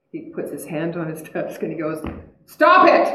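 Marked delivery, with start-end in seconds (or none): 2.5-3.1 high, loud mock-stern outburst; explosive punchline yell; sudden comic shout. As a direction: burst out with the psychiatrist's blunt order. Psychiatrist's sudden yelled punchline.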